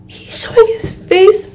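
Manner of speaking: high-pitched gasping disbelief; gasp, then ask in high amazement; astonished. Real, not acted. Astonished at helmet coming off.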